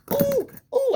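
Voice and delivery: real delight; excited squeal. Real, not acted. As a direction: squeal with glee at the find. Finds spreaders in the bag.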